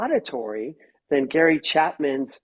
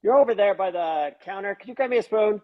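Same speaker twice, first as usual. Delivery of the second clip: brusque request; 6 semitones higher than usual. Shown as a demonstration. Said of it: "If it's".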